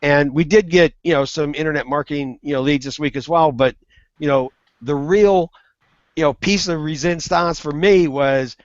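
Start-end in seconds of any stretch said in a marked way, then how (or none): none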